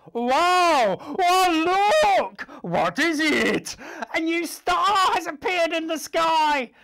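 high excited exclaim; wide-eyed astonishment; amazed wise men. Acted. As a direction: marvel at the new star. Wise men see star.

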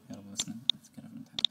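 whispered wish to oneself; hushed plea under the breath; muttered little prayer. Real, not acted. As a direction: drop to a barely voiced whisper. Muttered prayer about the exam.

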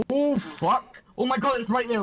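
sudden fright at the bear; panicking gamer. Real, not acted. Bear suddenly appears in game.

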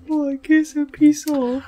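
soft, shy, small voice; cute anime rabbit voice. Retold or imitated. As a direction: squeak it like a cute bunny. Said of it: "a cute rabbit in an anime".

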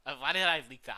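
loud exasperated yell; frustrated shout. Real, not acted. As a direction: shout at your own mistake. Game mistake.

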